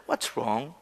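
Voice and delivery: low, soft questioning; gentle God asking. Acted. God coming to Cain asking what's wrong, soft and low. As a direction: ask it low and caring. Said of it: "하나님 가서".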